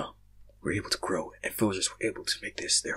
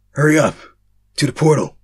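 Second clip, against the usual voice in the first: urgent shout; 6 semitones higher, 10 dB louder than usual. Acted. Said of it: "the masked figure said".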